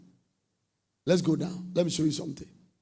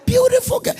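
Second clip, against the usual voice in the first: loud, very high exclamation; high-pitched pulpit play-acting; mock calling out; 12 dB louder than usual. Acts out calling to a girl.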